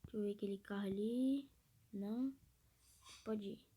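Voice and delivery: sussurro sem voz; sussurrando. Real, not acted. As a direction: fala só no ar. Mudança para fala baixa.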